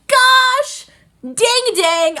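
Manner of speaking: loud, high, very drawn-out exclamation; theatrical high-pitched frustration; frustrated outburst. Real, not acted. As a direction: stretch it into an exasperated wail. Frustrated at waiting for next episode.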